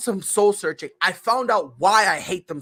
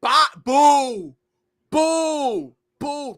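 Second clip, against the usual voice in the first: booing; 6 semitones higher, 4 dB louder, much slower than usual. Retold or imitated. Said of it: "now I'm like".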